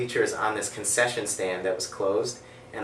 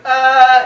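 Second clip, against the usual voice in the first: high, loud mock scream; scream of pain; 13 semitones higher, 13 dB louder than usual. Retelling the doctor's resetting, mock scream of pain. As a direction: yelp as if the wrist is being twisted.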